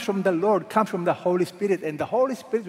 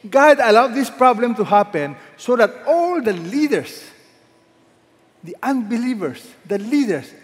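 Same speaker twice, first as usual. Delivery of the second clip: loud, raised preaching climax; preaching at full force; 3 semitones higher, 6 dB louder than usual. Acted. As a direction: build the call to the whole nation.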